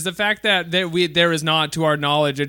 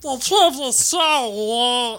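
slow, high Meatwad voice; 5 semitones higher, much slower than usual. Retold or imitated. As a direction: drawl it out as Meatwad. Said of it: "I can only do Meatwad".

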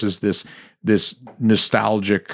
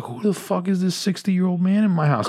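shocked; 10 semitones higher than usual. Retold or imitated. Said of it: "And I was like".

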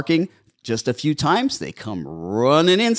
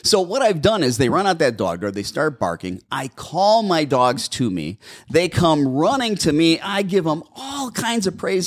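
usual speaking voice

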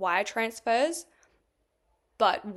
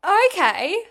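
loud okay; 9 semitones higher, 12 dB louder than usual. Retold or imitated. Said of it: "And I was like".

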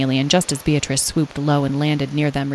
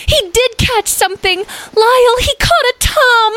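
very high, loud squealed news; overexcited high character voice, climbing; wildly excited; 6 dB louder than usual. A narrator's reading. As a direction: shriek the news with delight. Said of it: "flying into the air in excitement".

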